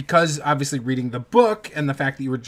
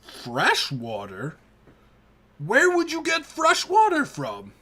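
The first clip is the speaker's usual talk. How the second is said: put-on incredulous old orc; 9 semitones higher than usual. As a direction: act stunned at the offer of water. Orc questions the fresh water offer.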